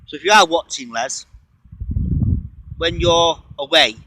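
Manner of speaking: calling out. Real, not acted. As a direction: raise the voice to reach Les. Calling out to Les.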